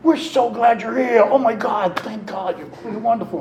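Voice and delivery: hammed-up overjoyed welcome; gushing welcome. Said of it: "didn't jump up and say".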